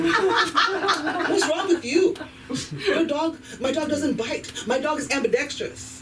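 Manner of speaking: high, indignant mock dog owner; hammed-up owner defending the pet; pushy dog owner. Dog owner scolding someone afraid of dogs, pushy mock voice. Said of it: "every time I meet a dog owner".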